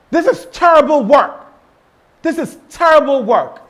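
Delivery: high, loud, scolding; harsh criticism. Shown as a demonstration. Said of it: "Instead of saying that".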